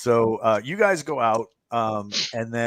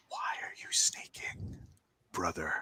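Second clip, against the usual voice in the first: creepy whisper; 5 semitones lower, 12 dB quieter than usual. Acted. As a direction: whisper it right into their ear. Game master voices creature at player's ear.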